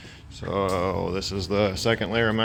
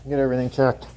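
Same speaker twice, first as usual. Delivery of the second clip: louder and higher through a respirator; through a face mask; 7 semitones higher, 4 dB louder than usual. Real, not acted. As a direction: talk through a paint mask. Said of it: "Hopefully you can hear me through my mask".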